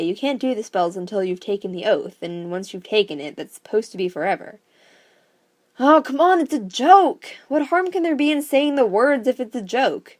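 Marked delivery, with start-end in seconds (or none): 5.8-10.2 eager protest. Acted. Nita talking herself into it.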